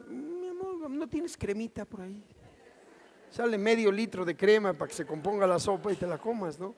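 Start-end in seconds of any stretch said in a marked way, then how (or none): 0.1-2.2 soft, coaxing request to his wife; much softer, tactful plea; sweet-talking wife. Retold or imitated. Husband tactfully asks wife for cream, softly coaxing.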